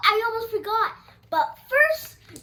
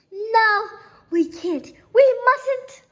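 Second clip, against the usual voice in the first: louder, higher, an alarmed no; loud refusal; 4 semitones higher, 8 dB louder, slower than usual. Acted. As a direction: stop yourself from pushing it. Larry refuses to push button.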